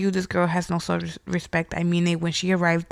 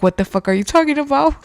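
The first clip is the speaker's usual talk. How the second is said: retold outburst; 7 semitones higher, faster than usual. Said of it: "there like".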